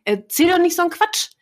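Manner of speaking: high teasing scold, lightly played; teasing teacher. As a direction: tease her gently. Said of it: "Und ich sag ihr immer".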